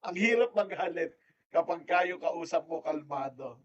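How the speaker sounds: usual speaking voice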